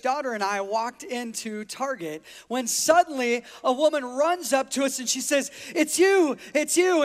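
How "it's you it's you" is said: mock-gushing fan, higher and louder; excited fan. Woman runs up to a supposed celebrity in Target, excited. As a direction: imitate a thrilled, starstruck fan. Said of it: "jumping up and down and hyperventilating".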